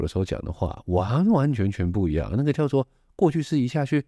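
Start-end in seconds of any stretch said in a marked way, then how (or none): none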